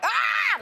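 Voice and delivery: put-on high startled teen yelp; mock teenage scream. Teenager seeing naked parents, high mock scream. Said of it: "you're like".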